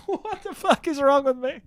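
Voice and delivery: high-pitched words through laughter; real laughing. Real, not acted. Laughing at his own story about a nine-year-old, real laughing. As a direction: laugh helplessly at yourself.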